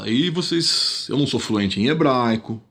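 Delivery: ordinary talk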